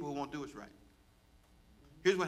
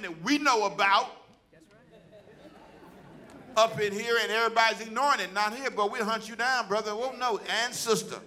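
preaching at full force; 6 semitones higher, 5 dB louder than usual. Acted. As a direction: drive it home to the church, louder and higher.

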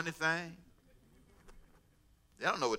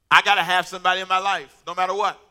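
loud, emphatic acted insistence; desperate insistence; 7 dB louder than usual. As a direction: insist loudly, as someone who will accept anything. Voices a desperate person's insistence.

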